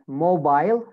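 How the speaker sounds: British "mobile", ending like "bile"; British accent. Shown as a demonstration. Teacher models British "mobile" for students, long I. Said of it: "In British accent".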